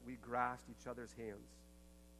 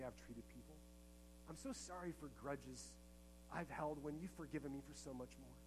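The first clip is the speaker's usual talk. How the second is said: heartfelt prayer; 2 semitones higher than usual. Acted. Preacher voices his own prayer.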